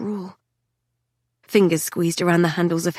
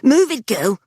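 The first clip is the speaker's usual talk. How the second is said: put-on northern accented bark; 5 semitones higher, 5 dB louder than usual. A narrator's reading. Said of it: "northern accented and scorched".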